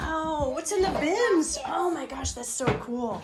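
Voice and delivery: high, delighted praise; high-pitched gushing wonder. Real, not acted. Admiring children's toys.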